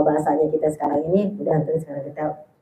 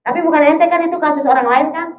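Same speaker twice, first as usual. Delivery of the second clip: normal talking voice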